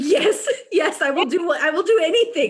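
high, laughing, eager burst of yeses; imitating her overeager teenage self. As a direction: jump at the offer, laughing. Eager yes to a chance.

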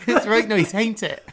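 loud, high-pitched Norfolk accent; exaggerated Norfolk demo. Shown as a demonstration. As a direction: belt it out in broad Norfolk. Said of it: "the Norfolk accent is like".

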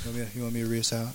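soft, low and fast; barely projected, hurried offer; very quiet. Real, not acted. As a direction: say it quickly under your breath. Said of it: "Am I really quiet?".

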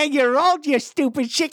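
high, cackling mock heckler; taunting inner voice. His inside voice mocking him for aging, high cackling taunt. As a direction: jeer in a high, put-on little voice. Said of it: "my inside voice is like".

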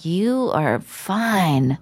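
very slow, drawn-out pick-up line; imitated slow come-on. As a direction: stretch out each word as an imitated admirer. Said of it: "he looked at me and he was like".

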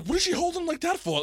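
high, incredulous question; retold shock at the nurse; mock alarm. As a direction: ask in disbelief.

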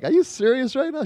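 high-pitched retold disbelief; incredulous. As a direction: ask it way up high, mock-astonished. Retelling disbelief at mother's answer.

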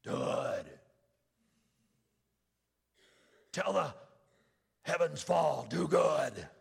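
gravelly voice. Retold or imitated. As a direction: rasp out the old motto. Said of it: "He did it in a gravelly voice".

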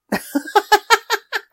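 high, bubbly run of laughter; laughing. Real, not acted. Podcaster telling listeners to come find her, laughing. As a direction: laugh in a quick high burst.

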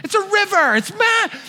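high, whiny exasperated voice; high incredulous complaint; exasperated protest. Retold or imitated. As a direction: plead the absurdity. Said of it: "I'm like".

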